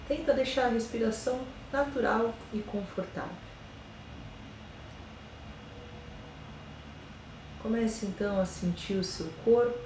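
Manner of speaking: slow, soft meditation voice with long pauses; guided meditation. Acted. Yoga teacher guiding listeners through a body scan, slow and soft meditation voice. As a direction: ease them into calm, one body part at a time.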